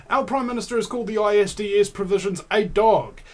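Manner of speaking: ordinary talk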